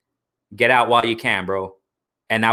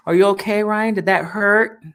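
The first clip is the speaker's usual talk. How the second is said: high, mock-gentle therapist voice; mock psychologist; 8 semitones higher than usual. Mocking Ryan with an imagined caring ring psychologist, high mock-tender voice. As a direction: fake caring doctor checking on a fighter. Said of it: "no psychologist in the ring that's gonna say".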